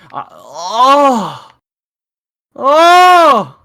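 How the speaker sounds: rising high squeal of delight; a loud overexcited cry; excited squeal. Real, not acted. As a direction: fan squealing at the song. Excited by the insert song.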